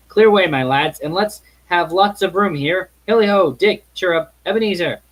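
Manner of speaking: jolly rallying shout. Acted. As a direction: rouse the room for a dance. Fezziwig rousing his lads.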